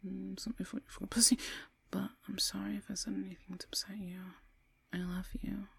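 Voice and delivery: soft, halting, half-whispered; murmured texting. Acted. Woman typing an apology to boyfriend, murmured. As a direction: say it under your breath as you type. Composing apology text alone.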